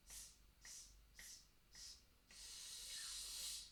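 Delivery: very soft unvoiced s pulses growing a little; hissed s crescendo. Shown as a demonstration. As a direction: hiss s s s from the abs, building. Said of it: "let's do a, a s sound".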